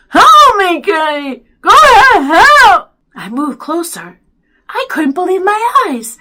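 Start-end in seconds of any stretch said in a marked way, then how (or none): 0.1-2.9 very loud, high garbled cry; mouth-full mumbled yell. A narrator's reading. Byron cries to brother for help, garbled wailing. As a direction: scream for help with useless lips. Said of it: "sounding like he had something in his mouth".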